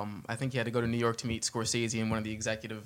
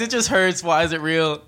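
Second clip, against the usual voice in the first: laughing through the words, voice pitched up and loud; cracking up while protesting; 7 semitones higher, 9 dB louder than usual. Real, not acted. Guido tracksuit teasing among friends.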